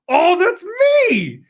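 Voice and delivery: loud sudden delighted shout; surprised shout. Retold or imitated. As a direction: burst out in surprise at his name. Finds his own name in mod.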